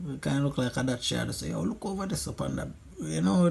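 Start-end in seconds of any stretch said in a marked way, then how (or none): 1.5-2.7 put-on Jamaican accent. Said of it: "Jamaicans stay already, when they say".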